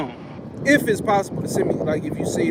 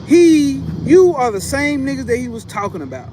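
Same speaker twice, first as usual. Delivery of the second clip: heated; 6 semitones higher, 3 dB louder than usual. Real, not acted. Heated rebuttal to critics.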